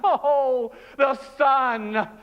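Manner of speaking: a freed prisoner stepping out into the sunlight, overjoyed; joyful relief. Acted. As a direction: greet the sun like a freed man.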